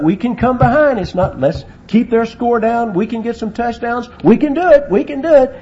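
loud, high-pitched rallying cries; coach's pep talk. Acted. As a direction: keep urging the team on, building to a shout. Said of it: "and you say".

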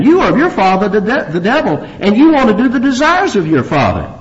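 high, emphatic denunciation; scorching rebuke. Acted. Jesus denouncing those who claim Abraham, scorching rebuke. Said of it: "he really scorches them here".

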